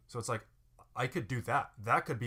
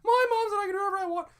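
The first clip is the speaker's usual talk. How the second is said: put-on very high, loud, quick kid squeal; piercing childish boast; loud falsetto; 9 dB louder, faster than usual. Shown as a demonstration. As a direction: shriek it in full falsetto. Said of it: "like, falsetto, very loud".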